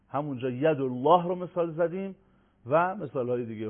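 ordinary delivery

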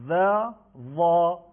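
slow "ذا" light, then "ظا" thick and dark; thin versus heavy. Shown as a demonstration. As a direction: contrast a thin "ذا" with a full, heavy "ظا", drawn out. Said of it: "فرقش در اینه".

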